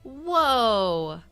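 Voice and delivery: real amazement at the scoreboard; amazed whoa. Real, not acted. Reacting to the match stats with amazement. Reacting to a monster game stat.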